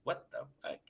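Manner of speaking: a voiceless whisper of shock; whispered curse. Retold or imitated. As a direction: breathe the curse out with no voice at all. Shocked at a knock on back door.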